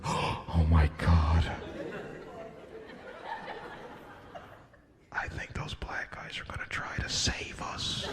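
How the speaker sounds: frightened whispered aside; whispering. Retold or imitated. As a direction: hush it like scared passengers. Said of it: "They were like".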